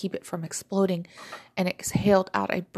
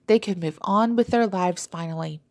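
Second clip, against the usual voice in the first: usual speaking voice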